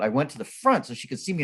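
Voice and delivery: ordinary delivery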